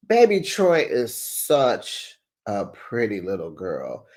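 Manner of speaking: cooing. Real, not acted. Cooing over the baby.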